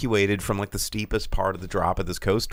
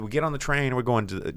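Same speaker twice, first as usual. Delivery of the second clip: usual speaking voice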